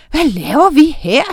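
high, loud puzzlement; startled, swooping query; bewildered question. A narrator's reading. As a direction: ask it as if just waking from a spell. Startled character coming to his senses.

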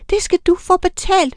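high, shrill-free angry howl of revenge; high angry witch threat; furious howl. A narrator's reading. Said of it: "hylede de".

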